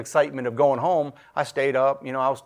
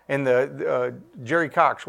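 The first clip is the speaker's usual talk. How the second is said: ordinary talk, nothing marked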